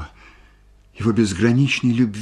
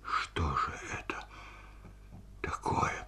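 hushed, dread-filled thought; horrified whisper; 5 semitones lower, 16 dB quieter than usual. A narrator's reading. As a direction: whisper it as horror creeps in. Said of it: "холодея от ужаса". (шёпотом, холодея от ужаса)

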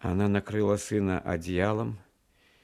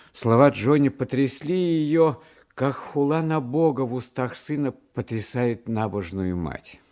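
usual speaking voice

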